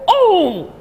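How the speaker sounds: high, drawn-out acted gasp of "Oh!"; sudden mock-horrified "Oh!"; shocked "Oh!". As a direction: cry out a high "Oh!" as if something just broke. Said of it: "and I go".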